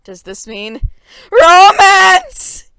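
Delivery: very loud, rising squeal on romance; hopeful squeal. A narrator's reading. Girl hoping for romance with Harry, loud squealing rise. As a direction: squeal it with longing.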